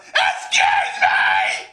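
loud screeched catchphrase, hugely drawn out; Vicky Guerrero. Acted. Streamer mimicking a wrestling personality, screeched impression. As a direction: stretch it slowly into an abrasive shout. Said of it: "sound like Vicky Guerrero".

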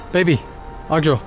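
ordinary delivery

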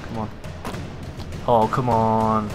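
normal talking voice

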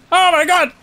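squealing laugh then a shout; high shrieking laugh. Real, not acted.

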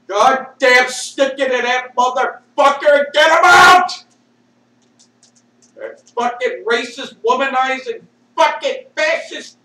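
full-throated angry outburst; angry yelling. Real, not acted. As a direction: yell it at the top of your lungs. Yelling in anger mid-rant.

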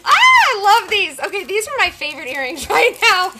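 high, loud giddy gush; excited gushing. Real, not acted. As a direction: squeal with delight over earrings. Gushing over earrings.

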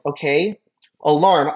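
ordinary talk, nothing marked

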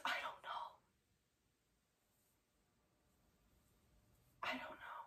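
barely audible, broken off by long pauses; tearful retold whisper. Crying husband answers his wife, in a tearful whisper. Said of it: "he starts crying".

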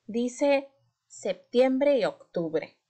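slow, careful reading aloud. Shown as a demonstration. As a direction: read it slowly for a child. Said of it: "vamos a leer este poema".

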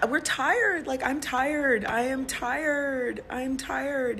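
high, plaintive voice, slowing down; tearful weariness. Real, not acted. Woman telling fans she is tired, tearfully pleading. Upset owner pleading, worn out.

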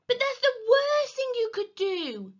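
high, loud wail of warning; desperate shout. A narrator's reading. Henry stopping Peter going to Mum, shouting. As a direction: call after him in panic. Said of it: "shouted Henry".

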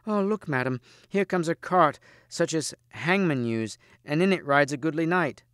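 high-pitched damsel calling out the sighting; high, eager announcement; excited exclamation. A narrator's reading. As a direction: exclaim from a lookout, pitched well up.

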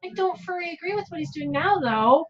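high sidekick voice. Acted. Companion continues.

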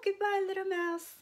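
high, hurried-off storybook goodbye; alarmed farewell. Acted. Owl takes leave of little mouse, alarmed. Owl flees from being eaten.

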